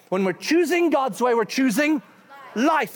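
loud, ringing pulpit delivery; preacher pressing life over death; preaching. Real, not acted. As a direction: drive the point home. Preaching climax.

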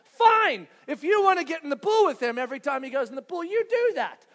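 high, loud, fast snap at his wife; exasperated retort. Retold or imitated. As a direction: fire back in an argument. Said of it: "To which I respond".

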